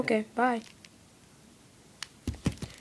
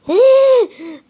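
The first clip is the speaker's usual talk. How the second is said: sharp high gasp; loud gasp; 9 semitones higher, 9 dB louder than usual. Acted. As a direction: gasp in outrage. Outburst from the cat toy.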